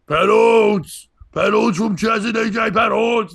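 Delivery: put-on loud drunken yelling of a name; drunk shout. Drunk guy in the crowd yelling the comic's name, loud shout. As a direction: bellow the name like a rowdy drunk.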